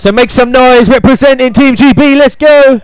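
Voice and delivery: announcer firing up the fans; hype call. Real, not acted. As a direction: pump up the crowd. Hyping crowd for Team GB.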